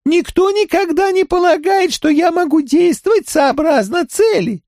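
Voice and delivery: very high monotone alien voice; high, flat and mechanical, like a crab creature. Acted.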